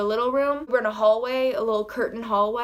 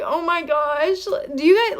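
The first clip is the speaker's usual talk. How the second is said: overwhelmed gasp; 6 semitones higher, 5 dB louder than usual. Retold or imitated. Fan meets idol, gushing.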